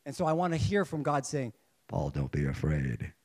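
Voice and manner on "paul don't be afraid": very low, slow God voice; mock divine voice, deep and slow. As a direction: boom it out low like God speaking. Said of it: "I don't think his voice sounds like that".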